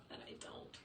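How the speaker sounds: rueful whisper; wistful whispered admission; whispered aside. Real, not acted. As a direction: drop to a whisper.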